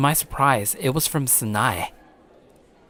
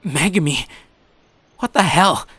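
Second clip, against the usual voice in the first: higher and louder, stunned shout; shocked outburst; 5 semitones higher, 5 dB louder than usual. Acted. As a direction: recoil in shock at what she said. Shocked at friend's death wish.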